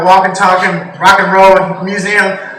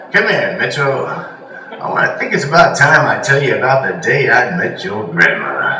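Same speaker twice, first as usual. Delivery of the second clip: put-on much lower, softer old-man voice; old grandfather; 8 semitones lower, 6 dB quieter than usual. Grandfather calling his grandson over, low soft old voice. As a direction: settle in like a grandpa starting a tale. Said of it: "he was like".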